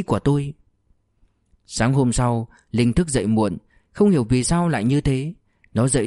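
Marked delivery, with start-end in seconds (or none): none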